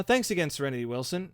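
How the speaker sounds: normal talking voice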